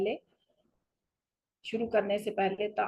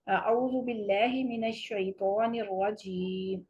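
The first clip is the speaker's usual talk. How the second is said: slow chanted tajweed, halt at the end; recite, then stop fully; slow recitation with a stop; slower than usual. Shown as a demonstration. Teacher demonstrates pausing after the taawwuz. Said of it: "یہاں ہم stop کریں گے".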